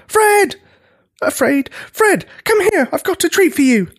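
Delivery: high, strained call for help; high-pitched frightened coaxing; panicky calling out. A narrator's reading. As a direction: call the child over, barely holding off panic. Scared giant calls Fred for help.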